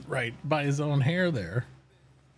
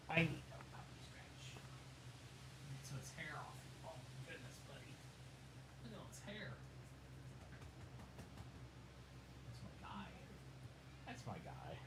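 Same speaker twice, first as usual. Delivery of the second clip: whispering to dog; 6 semitones higher, 19 dB quieter than usual. Real, not acted.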